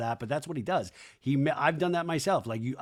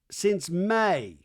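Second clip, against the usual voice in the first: ordinary talk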